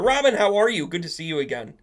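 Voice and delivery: high, loud hello; real excited welcome; delighted greeting. Real, not acted. Greeting a viewer who arrived.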